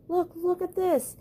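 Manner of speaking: high, eager repeated call; calling his friend over in delight; excited chipmunk cry. Acted. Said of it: "cried Dale".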